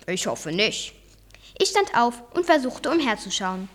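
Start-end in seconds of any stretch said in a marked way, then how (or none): 0.1-0.9 low, soft, composed voice; reply low and unruffled; calm uncle. A narrator's reading. Uncle answering his worried nephew, calm.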